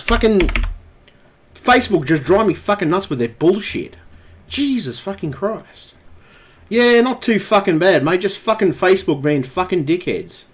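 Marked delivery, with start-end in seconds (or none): none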